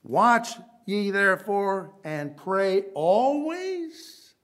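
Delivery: "always" stressed, slow and drawn out; slow, weighty "always"; emphasis on always. Shown as a demonstration. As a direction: lean on "always". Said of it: "Emphasis on the word always".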